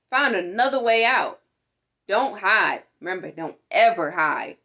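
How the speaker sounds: ordinary delivery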